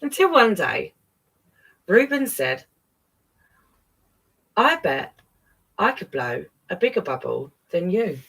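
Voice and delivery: storybook read-aloud, lively; gentle animated story narration; reading to children. A narrator's reading. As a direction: read it like bedtime to kids. Reads picture book to children.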